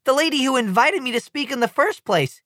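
plain reading voice

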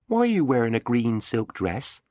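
high, puzzled question; puzzled girl. Acted. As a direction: ask in wonder. Meg asks puzzled.

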